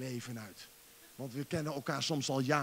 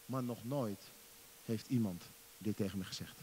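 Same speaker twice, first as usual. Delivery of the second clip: usual speaking voice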